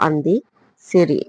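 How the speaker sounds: ordinary delivery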